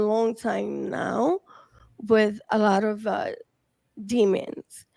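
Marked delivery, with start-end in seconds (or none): none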